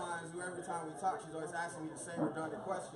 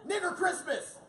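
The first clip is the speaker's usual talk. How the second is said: mock homeless man yell; 10 semitones higher, 6 dB louder, slower than usual. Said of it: "He goes".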